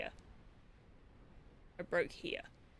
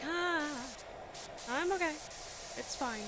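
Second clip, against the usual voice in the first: brushing off pain; 9 semitones higher, 4 dB quieter than usual. Retold or imitated. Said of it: "I'm just like".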